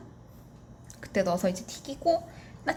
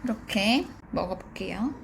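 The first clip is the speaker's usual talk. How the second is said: ordinary delivery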